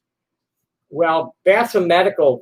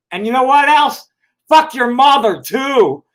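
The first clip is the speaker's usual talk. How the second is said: higher, louder, raging at him; angry outburst; 7 semitones higher, 4 dB louder than usual. Real, not acted. Ranting at an anonymous troll, loud and furious. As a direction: lash out at the troll, loud and high.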